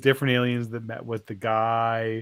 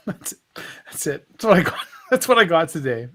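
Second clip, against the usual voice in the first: real laughter through the words, louder; laughing; 3 semitones higher, 4 dB louder, faster than usual. Real, not acted. Joke teller wrapping up after his punchline, laughing. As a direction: chuckle your way through the sign-off.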